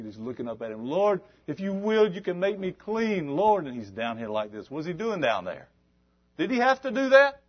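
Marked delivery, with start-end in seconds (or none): 0.9-3.7 high, slow begging plea; pleading leper. Acted. Leper pleading on his knees. 6.4-7.4 a much higher, louder challenge; emphatic question. Acted. Preacher's rhetorical question.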